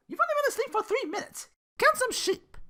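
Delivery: high, loud put-on voice, fed up; irritated dismissal. Belle telling Duke to count sheep, high and irritated. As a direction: swat him off like a pest.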